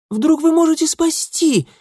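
put-on high, loud girlish excitement; eager chiming in. A narrator's reading. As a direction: chime in eagerly, pitched very high. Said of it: "подхватила другая".

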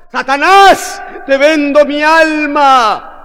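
high, drawn-out yell; loud, slow, hammed-up desperation; desperate acted shout. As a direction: call out to the devil with all your might. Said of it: "comienza a gritar".

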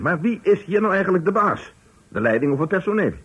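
loud, high furious demand; angry shout. Acted. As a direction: roar who's in charge. Boss shouting about authority.

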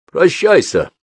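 high, loud mocking permission; smirking. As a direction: say it with a sneer. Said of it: "ухмыльнулся брат".

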